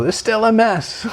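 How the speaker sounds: exasperated parent. Retold or imitated. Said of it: "you go".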